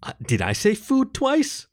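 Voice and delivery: high, slow mock puzzlement; comic self-query. A narrator's reading. Topher working his joke for LaShonda, mock-puzzled. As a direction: stretch the question up high.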